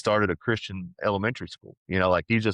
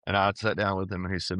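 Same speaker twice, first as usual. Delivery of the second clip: ordinary talk, nothing marked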